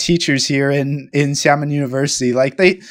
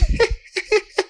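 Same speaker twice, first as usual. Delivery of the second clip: high put-on laugh; imitated laugh; 5 dB quieter than usual. Showing co-host his own laugh, high imitation. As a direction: mimic a co-host's high laugh. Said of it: "I can kinda do your laugh".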